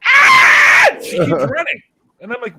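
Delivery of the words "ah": woman's scream. Retold or imitated. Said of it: "she screamed and ran away".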